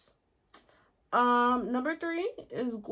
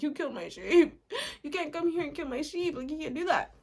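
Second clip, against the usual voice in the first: higher-pitched, playful imitation of an outraged Cyclops; hammed-up indignant complaint, pitched up; mock-angry Cyclops; 6 semitones higher than usual. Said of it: "the Cyclops is so mad".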